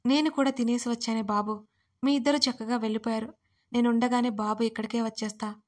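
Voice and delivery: ordinary delivery, nothing marked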